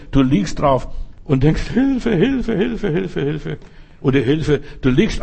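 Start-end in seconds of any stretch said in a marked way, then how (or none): 1.7-3.6 mock cries for help. Preacher mimicking a despairing believer, high then fading. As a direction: whine it, then let it die away. You lying there crying help.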